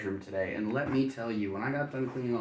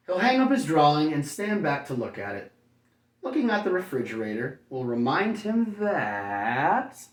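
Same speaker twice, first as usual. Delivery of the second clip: bright, lively storybook read, higher and louder; reading to children; 4 semitones higher, 6 dB louder than usual. A narrator's reading. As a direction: read it like bedtime story to little kids. Reads picture book to children.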